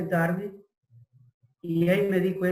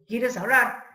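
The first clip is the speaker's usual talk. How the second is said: higher, eager and delighted; overjoyed doctor offering a call; happy doctor; 5 semitones higher than usual. Retold or imitated. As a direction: beam as you offer the phone. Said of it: "el médico era contentísimo".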